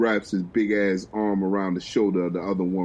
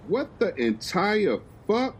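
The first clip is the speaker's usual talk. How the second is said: stunned Uncle; 10 semitones higher, slower than usual. Acted. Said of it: "Uncle is like".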